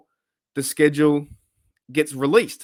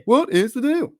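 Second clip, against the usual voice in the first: high-pitched mock stand-up comic catchphrase; Jerry Seinfeld; 12 semitones higher, 3 dB louder than usual. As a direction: burst into a squeaky comedian parody. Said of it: "trying to do my Jerry Seinfeld impression".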